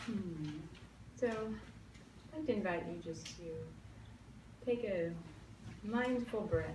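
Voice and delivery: calm meditation voice with long pauses; guided meditation. Acted. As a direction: lead them gently into stillness.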